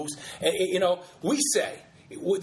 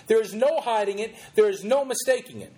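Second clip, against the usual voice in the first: usual speaking voice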